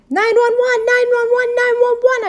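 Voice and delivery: high, loud emergency holler. A narrator's reading. Girl calls for help over stolen mittens, hollering. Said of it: "I hollered".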